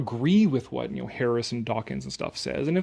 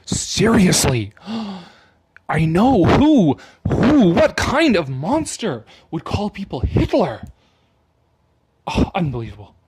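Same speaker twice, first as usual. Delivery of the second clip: hammed-up shocked outrage, much louder and higher; mock outrage; 3 semitones higher, 8 dB louder, slower than usual. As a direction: play at being scandalized, gasping. Sarcastic outrage at article.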